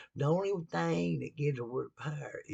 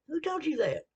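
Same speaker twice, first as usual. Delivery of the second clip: higher, louder comeback, "Who told you that?"; indignant retort; 13 semitones higher, 3 dB louder than usual. Retold or imitated. Preacher answering his critics, indignant and higher. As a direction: fire the question right back at them.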